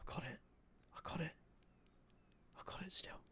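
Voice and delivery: whispered, holding breath; tense whisper. Real, not acted. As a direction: whisper so you don't lose it. Tense moment holding the fish.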